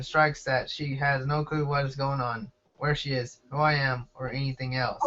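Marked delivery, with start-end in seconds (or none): none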